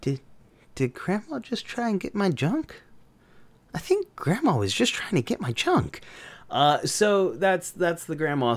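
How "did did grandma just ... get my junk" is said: high, hushed, baffled voice; stunned disbelief. Retold or imitated. Man staring after grandma, hushed and baffled. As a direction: ask it in quiet shock. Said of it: "looking at this lady like".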